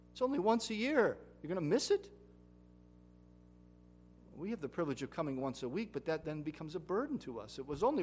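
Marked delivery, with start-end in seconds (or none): 0.1-2.1 loud, high disbelief at missing it; incredulous rebuke. Acted. Preacher scolding the congregation, loud and incredulous. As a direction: demand it in disbelief.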